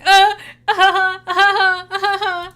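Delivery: loud, high staccato fake laugh; forced laughter. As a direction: pump out a put-on laugh on command. Forced laughing after being told to.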